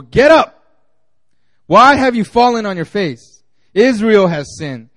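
loud, commanding voice of God; stern rebuke. Acted. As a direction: bark the order down at him. God's rebuke read aloud.

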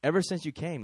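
ordinary talk, nothing marked

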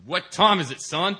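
loud, high barked question; furious boss shouting at the late young worker; boss yelling. Retold or imitated. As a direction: yell it like an angry boss at a late kid. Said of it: "And he yells at me".

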